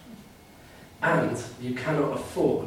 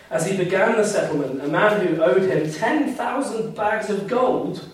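raised, measured Bible reading to the congregation; scripture reading; 3 semitones higher, 4 dB louder than usual. Acted. Preacher reading the parable to the church, lifted and measured. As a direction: read the parable like a proclamation.

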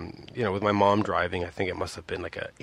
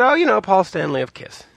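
feigned offhand tone, higher and louder; mock casual brag; 11 semitones higher, 7 dB louder than usual. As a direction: toss the name off like it's nothing. Kid casually naming big star.

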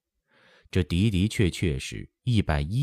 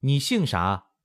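raised, high-pitched question; high, emphatic question to someone hard of hearing; speaking up loudly; 7 semitones higher than usual. A narrator's reading. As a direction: call it out for deaf ears. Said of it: "慧儿大声说".

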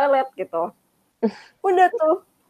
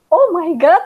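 mild mock dismay; 7 semitones higher, 5 dB louder, slower than usual. Exclaiming about reaction.

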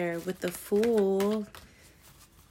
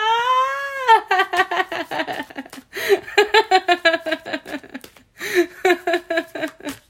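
high, loud laugh breaking into a cry; loud laughing fit; 12 semitones higher, 11 dB louder than usual. Real, not acted. Reader cracking up at her own acted scream, loud high laugh. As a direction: cackle hard and high at the joke.

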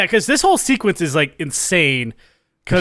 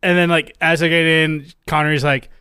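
ordinary talk

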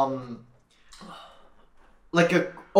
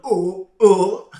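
gagging; 6 semitones higher, 9 dB louder than usual. Retold or imitated. Retelling starting to gag.

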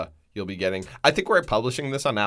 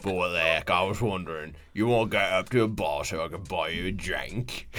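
put-on low, soft British young man, pitched well down; bad British accent; 8 semitones lower, 7 dB quieter than usual. British student asking Anna for a drink, low faked British. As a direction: ask her out shyly in a low British voice. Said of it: "Okay, Hagrid".

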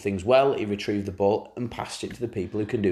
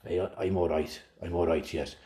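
low, softer put-on Welsh accent; mock Welsh accent; 6 semitones lower, 7 dB quieter than usual. Mark Hughes answering Keane's call, lightly voiced Welsh accent. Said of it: "doing the accent".

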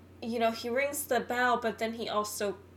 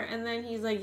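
normal talking voice

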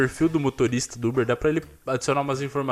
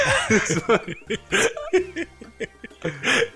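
high laugh; 12 semitones higher than usual. Real, not acted.

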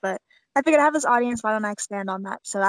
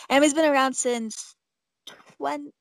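normal talking voice

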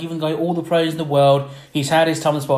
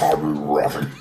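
lightly done monster voice, dropping much lower on the last word; deep auto-tune monster; 12 semitones lower than usual. Retold or imitated. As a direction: growl it like a robotic space monster. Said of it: "deep and, like, auto-tune voice".

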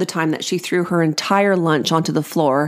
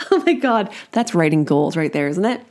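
ordinary delivery

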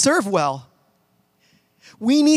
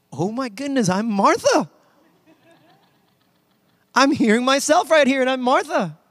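mock dismayed realisation; 5 semitones higher than usual. Pastor realising they are Martha, comic dismay. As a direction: exclaim it like a dawning, embarrassing discovery. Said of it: "I was like".

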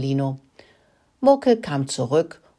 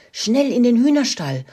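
higher-pitched hurried call; urgent call; 8 semitones higher, 4 dB louder than usual. A narrator's reading. Mouse father summoning his children to the henhouse, calling urgently. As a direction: call the children to come at once. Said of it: "rief seinen Kindern zu".

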